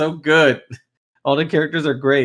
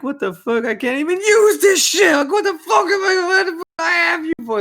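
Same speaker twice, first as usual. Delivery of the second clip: mock furious yell. Boss ranting at the henchman who grabbed the wrong things, angry high yell. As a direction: bellow it like an outraged crime boss. Boss yelling at clumsy underling.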